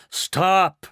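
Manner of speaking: loud high scream to halt. Acted. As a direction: cut him off with a yell. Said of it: "screamed ground control".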